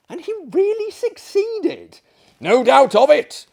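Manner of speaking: gleeful emphasis. Acted. Host relishing the rebel tale.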